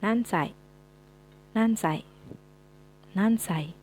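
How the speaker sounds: teacher modeling nansai; model pronunciation. Shown as a demonstration. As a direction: clear Japanese drill, nansai. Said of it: "Selanjutnya ada".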